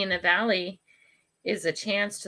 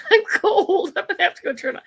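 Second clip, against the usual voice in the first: laughing; 13 semitones higher, 7 dB louder than usual. Real, not acted. Laughing while complaining of chill.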